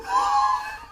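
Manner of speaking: high, airy laugh of real astonishment; amazed laugh. Real, not acted. Vlogger overjoyed at the gift, astonished laugh.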